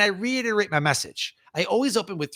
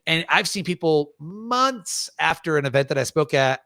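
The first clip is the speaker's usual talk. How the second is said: normal talking voice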